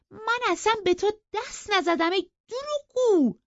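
high-pitched, outraged yell of denial; angry shout. A narrator's reading. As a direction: shout the accusation back at full pitch. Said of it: "داد زد".

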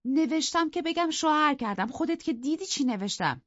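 put-on high young woman's voice, hurt; young wife, aggrieved. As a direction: plead that nobody knows her pain. Isabella voiced in the story.

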